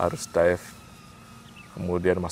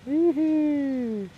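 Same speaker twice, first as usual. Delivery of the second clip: giggling. Real, not acted. Giggling at the flight.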